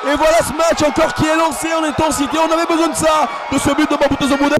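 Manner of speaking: high excited goal call; excited sports commentary. Real, not acted. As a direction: build up and celebrate.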